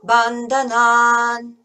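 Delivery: Bandhanan slow and loud, with a long final naan; accent on bandhanan. Shown as a demonstration. Teacher shows the long naan ending of Bandhanan. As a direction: stretch the last naan of Bandhanan. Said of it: "the accent on the bandhanan".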